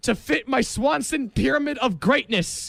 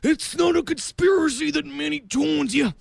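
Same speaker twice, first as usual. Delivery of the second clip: wrestler impression; 5 semitones higher than usual. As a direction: bellow it like a wrestler cutting a promo. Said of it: "Let's get out of my impressions".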